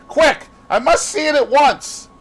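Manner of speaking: loud, very high, pressing; urgent exclamation. A narrator's reading. Dorian hurrying the gamekeeper to the body, exclaiming urgently. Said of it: "he exclaimed".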